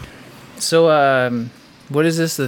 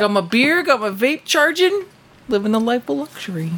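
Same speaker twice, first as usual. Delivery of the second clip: Pam impression; 11 semitones higher than usual. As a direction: stretch it out high, mimicking someone. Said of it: "That's my Pam impression".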